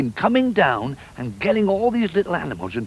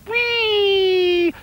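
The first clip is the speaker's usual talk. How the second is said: high, long sliding squeal; sliding whee; 13 semitones higher than usual. Acted. Duck going down the beanstalk slide, squealing.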